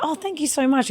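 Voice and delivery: high, soft, gushing thank-you; polite thanks. Retold or imitated. Me to the big man holding the gate, grateful.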